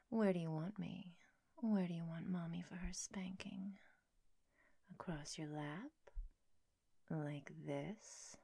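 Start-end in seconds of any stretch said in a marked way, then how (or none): 1.6-8.4 soft intimate whisper. Acted. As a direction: hush it, close and sweet. Mom roleplay whisper.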